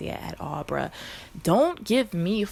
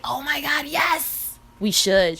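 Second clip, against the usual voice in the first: high, louder thrilled yes; excited squeal; 9 semitones higher, 8 dB louder, slower than usual. Retold or imitated. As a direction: gush with excitement. Retells her own excitement.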